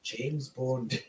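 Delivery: soft, badly faked British spy; quiet mock-British delivery; attempted British accent. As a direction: try a British secret-agent introduction. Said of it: "I challenge you to say this whole thing with a British accent".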